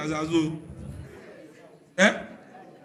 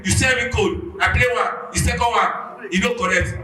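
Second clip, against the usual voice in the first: loud, high, aggrieved ranting; heated shouting rant; 4 semitones higher, 6 dB louder than usual. Real, not acted. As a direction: shout the whole grievance. Angry fan rants about codes.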